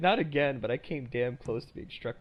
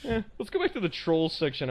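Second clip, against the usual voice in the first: higher, louder bored brush-off; mocking the uninterested audience, higher and louder; bored dismissive listener; 5 semitones higher, 5 dB louder than usual. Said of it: "have people go".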